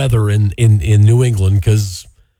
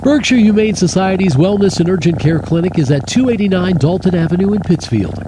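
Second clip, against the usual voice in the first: ad read; 5 semitones higher than usual. Acted. Host reads sponsor ad.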